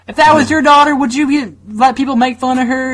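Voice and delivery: put-on high Southern-accented reproach; southern mom. Mom asking about your daughter, high Southern accent. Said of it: "she has a southern accent".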